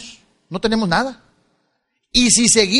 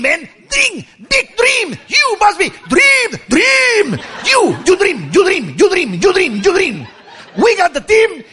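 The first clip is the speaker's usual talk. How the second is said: mock a pumped-up American salesman chanting dream; hyped presenter; 5 semitones higher, much slower than usual. Presenter hyping the crowd about dreams, mock shouting. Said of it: "Y luego comienza".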